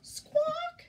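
high, loud imitated squawk; hen squawk. A narrator's reading. Reader voices the golden hen, high squawk. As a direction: squawk the word like a startled hen. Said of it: "he heard a".

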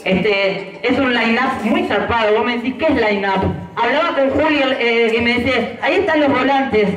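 ordinary talk, nothing marked

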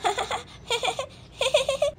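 put-on high, whimpering toddler calling for mummy; baby girl voice. Little girl calls mummy from the bathroom, whiny baby voice. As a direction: babble like a tiny child with giggles and little cries.

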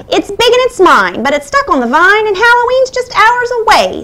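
high, fast witch voice. A narrator's reading. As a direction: squawk it like a cackling witch. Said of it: "said the witch".

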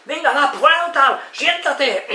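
high, loud drawn-out classroom shouting; bellowing teacher. Retold or imitated. Teacher berating a restless class, bellowing. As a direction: imitate a teacher yelling orders at the class. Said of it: "está berreando todo el rato".

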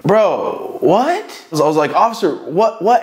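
high shocked protest; shocked disbelief. Retold or imitated. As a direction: gasp out a disbelieving what. Shocked as friend arrested.

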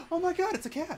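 high, loud gasp of delight; sudden high-pitched delight at a cat; delighted surprise. Real, not acted. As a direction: squeal it out, thrilled to see the cat.

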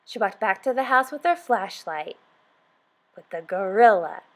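usual speaking voice

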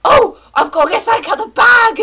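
loud, high mock fluster; panicky passenger. Passenger suddenly needing the bag, loud high mock panic. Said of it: "everybody decides".

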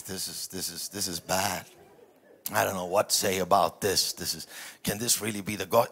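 ordinary talk, nothing marked

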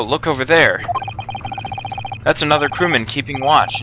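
usual speaking voice

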